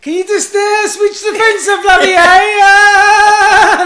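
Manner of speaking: high, loud shriek building to a long wail; mock screaming. Electrocuted fitter begging old woman to switch off, comic screaming. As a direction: yell it as if being electrocuted, hammed up. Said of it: "he's, like, screaming".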